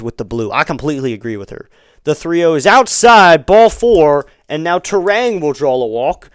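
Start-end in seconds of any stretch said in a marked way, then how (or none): 2.0-6.3 louder, higher call of ball four; animated play-by-play. Real, not acted. Announcer calls ball four on Turang, animated. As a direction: call the walk with a lift.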